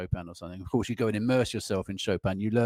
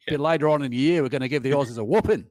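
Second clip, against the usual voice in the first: loud, higher-pitched mock Australian accent; 4 semitones higher, 7 dB louder than usual. Shown as a demonstration. Musician joking about beating the Aussies, put-on Australian accent. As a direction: tease in a hammed-up Australian accent. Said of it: "Australian accent".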